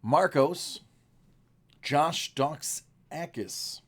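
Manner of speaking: ordinary delivery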